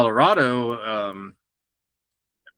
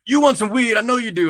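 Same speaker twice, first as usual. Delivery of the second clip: high, loud, teasing hustler voice; pushy street dealer; 11 semitones higher, 5 dB louder than usual. Retold or imitated. Jamaican dealer to a tourist in a gift shop, high teasing pitch. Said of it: "this dude's like".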